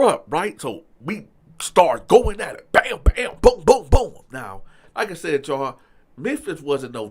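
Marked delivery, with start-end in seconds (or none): 2.7-4.1 loud, high rapid-fire blow noises; explosive bams and booms for a fistfight; imitated punch sounds. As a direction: punch out each hit with the voice.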